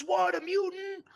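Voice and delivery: high, slow, amazed question; imitating surprise. Founder amazed by the mutant pillow, high slow surprised question. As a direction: let the surprise lift the voice way up.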